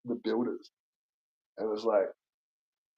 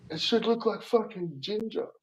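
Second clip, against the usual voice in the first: imitating insistent owner; 9 semitones higher than usual. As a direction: push the complaint up high and hard.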